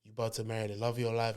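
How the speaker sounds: low, very soft murmur; hushed reassurance. Retold or imitated. Best man calming the groom, hushed low reassurance. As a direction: talk him down privately, close to his ear. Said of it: "talking shit in my ear".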